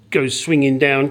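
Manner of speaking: usual speaking voice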